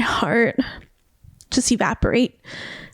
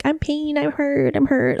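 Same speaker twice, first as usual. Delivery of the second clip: higher, mildly imitated hurt; mock a tearful lament, lightly; retold broken crying; 5 semitones higher than usual. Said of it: "I'm just crying, I'm broken".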